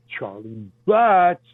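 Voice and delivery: stretched, slow name-calling; drawn-out mocking name. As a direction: drag the name out to make fun of it. Butt of the joke name.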